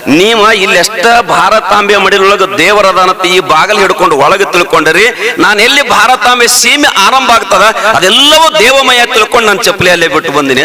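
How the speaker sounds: high, ringing sermon voice; fervent village preacher; preaching at full force. Acted. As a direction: preach it out to the crowd. Preacher voices Russian visitor.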